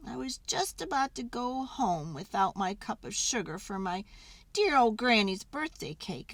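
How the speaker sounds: plain reading voice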